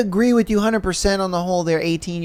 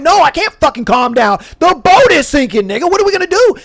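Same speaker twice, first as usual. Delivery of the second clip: high, loud panicked voice breaking upward; mock hysterical panic; freaking out; 7 semitones higher, 5 dB louder than usual. Nolan yelling at friends as boat sinks, panicked. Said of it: "Nolan's freaking out".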